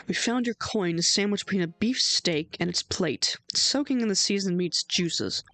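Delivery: ordinary delivery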